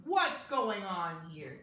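slow, loud lion growl. A narrator's reading. Fierce lions confronting Leonard, growling slow and loud. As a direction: growl it like a menacing pack. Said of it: "they growled".

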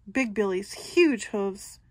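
ordinary delivery

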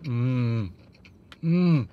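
chewing while humming; humming through closed lips; mouth full. Real, not acted. Seen 1: Cheeks full, lips closed, chewing; the bite happens before and after.

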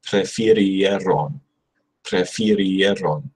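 Prefirieron drawn out slowly, every sound kept; careful pronunciation. Shown as a demonstration. Learner practising so no letters get skipped, slow careful Prefirieron. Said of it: "easy to just sort of skip over some of those letters".

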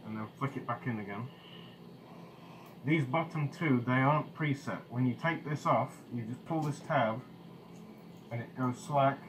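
muffled behind full face mask; speaking inside respirator; through a gas mask. Real, not acted. Seen 1: Full-face gas mask with canister worn throughout while adjusting straps.

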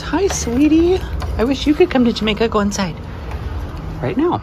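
high sing-song pet talk. Real, not acted. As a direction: coo at the dog. Talking to the dog.

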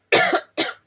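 short dry cough. Real, not acted. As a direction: cough dryly. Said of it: "Please ignore that".

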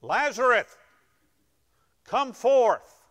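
loud cry. Acted. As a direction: call the dead man out of the tomb. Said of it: "he cried out in a loud voice".

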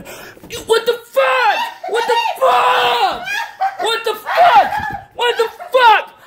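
very high shrieked cursing; drawn-out high shouts of fright; panicked screaming. Real, not acted. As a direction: scream in shock, over and over. Startled once more while signing off.

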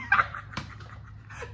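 squeaky breathless giggle; high giggle. Real, not acted. Correcting her day count to viewers, high giggle. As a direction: giggle at yourself. Laughing at her own slip.

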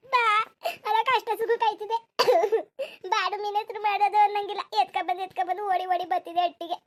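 high, tearful village lament; grieving daughter. Acted. Daughter lamenting the mother who always brought food, tearful. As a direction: wail to a mother who won't answer.

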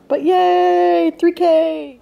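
high, loud little cheer; real joy at three thousand. Real, not acted. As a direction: squeal it out in celebration. Celebrating the subscriber count.

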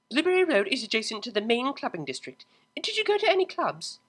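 urgent worry; Octavia. Acted. Octavia voiced.

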